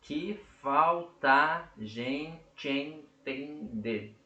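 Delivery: lento, juntando as vogais; escansão falada. Shown as a demonstration. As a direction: diga devagar, unindo vogais numa sílaba. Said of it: "quando eu falo". ("que falta a gente entender" bem devagar, vogais juntas)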